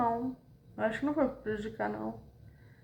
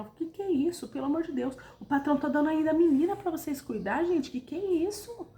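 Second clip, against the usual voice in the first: imitated customer complaint, pitched up; high, exasperated retold scolding; customers' scolding; 7 semitones higher than usual. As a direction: act out indignant customers telling staff off. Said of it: "começaram a, a xingar".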